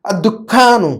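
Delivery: الدکانو drawn out with a held k; doubled kaf. Shown as a demonstration. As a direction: model الدکانو for students, k doubled and aa long. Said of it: "کاف پر بھی شدہ". (الدکانو، کاف پر زور دے کر)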